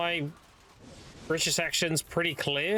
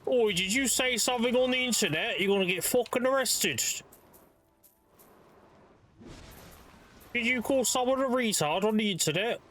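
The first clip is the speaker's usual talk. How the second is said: higher-pitched parody British policeman; put-on officious copper's challenge, pitched up; mock British copper; 6 semitones higher than usual. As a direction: accuse them like a hammed-up bobby. Mock British police threatening arrest.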